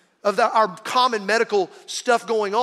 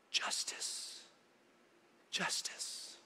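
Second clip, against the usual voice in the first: whispered justice; 18 dB quieter, much slower than usual. Real, not acted. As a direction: breathe the word justice unvoiced. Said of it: "This is the story of".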